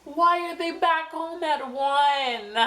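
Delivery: high, slow, half-laughing disbelief; high slow incredulous laugh. Retold or imitated. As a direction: ask in amused disbelief. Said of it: "I'm like".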